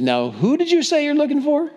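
rising to a very high-pitched question; high, challenging query; pointed questioning. Acted. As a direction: ask it again, voice climbing high at the end. Jesus challenging men who fell down.

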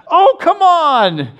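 put-on eight-year-old Jack copying dad's complaint; imitating his young son. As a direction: copy a kid copying his dad. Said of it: "Guess what? Jack".

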